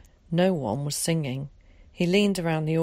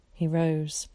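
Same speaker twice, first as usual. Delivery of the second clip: plain reading voice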